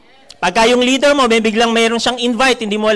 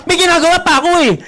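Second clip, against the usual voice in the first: fast, high, loud retort; impatient yell; 9 semitones higher, 4 dB louder, much faster than usual. Retold or imitated. Child yelling back to mother, fast high impatient retort. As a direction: brush mom off, hurried. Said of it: "Makasigaw kang ganon".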